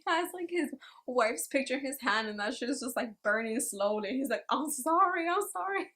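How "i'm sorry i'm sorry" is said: high, pleading repeated sorry; pleading apology. Acted. Homeless man apologizing to his wife's burning picture, high pleading. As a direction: beg forgiveness to the burning photo.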